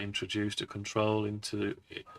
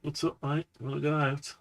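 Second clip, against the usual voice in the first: talking to a pet; 6 semitones higher, 4 dB louder than usual. Real, not acted. Talking to the cat.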